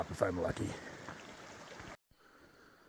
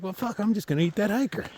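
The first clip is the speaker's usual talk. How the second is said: higher-pitched put-on voice of a bear; voiced frustrated bear; 9 semitones higher than usual. Bear giving up on the food bag, acted frustration. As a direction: speak as the bear changing its plan, pitched up. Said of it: "it's like".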